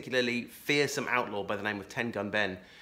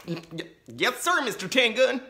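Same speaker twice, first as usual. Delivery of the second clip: high, cowed stammering reply; whimpering; 11 semitones higher, 4 dB louder than usual. A narrator's reading. Laura's dad surrenders to the outlaw, high and cowed. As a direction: give in, scared. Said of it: "whimpered Laura's dad".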